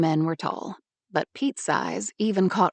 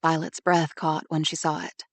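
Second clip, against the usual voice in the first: usual speaking voice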